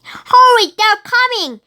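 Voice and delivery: loud high shout of warning; panicked call; urgent shout. Acted. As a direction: yell the warning. Fleeing pirates.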